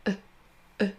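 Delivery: soft, relaxed uh sound for E; E as a gentle barely heard uh; schwa sound. Shown as a demonstration. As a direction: say E as a short soft schwa, lips loose. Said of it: "Là, le son voyelle".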